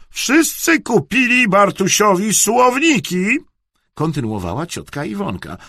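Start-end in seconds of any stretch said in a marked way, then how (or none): 0.1-3.4 high, loud, slow reproach; scolding. A narrator's reading. Aunt comparing presents, scolding loudly. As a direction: rub it in, pointedly drawn out.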